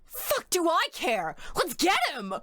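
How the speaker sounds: high mocking Junpei. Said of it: "And Junpei's like".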